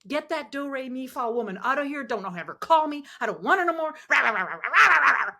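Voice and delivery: angry veteran swearing on the phone; mock angry rant. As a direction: ham up a cranky old man. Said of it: "swear and".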